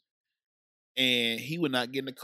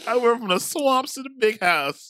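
high, loud, laughing joke; 9 semitones higher, 6 dB louder than usual. Real, not acted. Joking with his friend about his Birkenstocks, laughing hard. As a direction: crack himself up while bragging.

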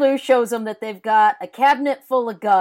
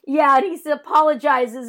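ordinary talk

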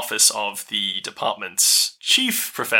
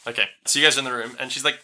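normal talking voice

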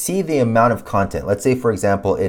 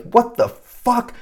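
higher, slow drawn-out disbelief; disbelieving; 12 semitones higher, slower than usual. Retold or imitated. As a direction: stretch it out, stunned.

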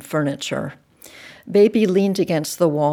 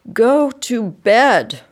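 slow, firm, raised voice; louder, drawn-out command; stern parental order; 5 semitones higher, 6 dB louder, much slower than usual. A narrator's reading. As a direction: order the child off to bed. Parent orders child to bed.

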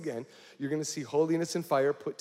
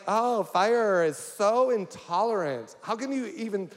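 mock outrage; 4 semitones higher, 5 dB louder than usual. Mocking imagined outraged blogger.

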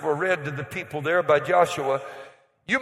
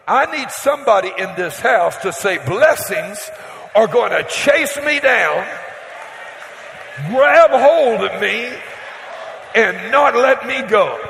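rising preacher shout; 5 dB louder than usual. Real, not acted. Preacher rouses congregation.

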